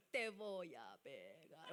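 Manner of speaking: calm mock threat. Serene type mock-threatening, low, soft and slow. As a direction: threaten as gently as possible.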